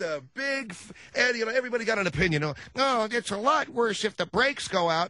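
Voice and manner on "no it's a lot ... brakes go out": put-on high, slow whiny kid voice; high, drawn-out child insisting; kid arguing. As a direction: argue like a stubborn child.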